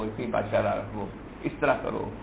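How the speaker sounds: normal talking voice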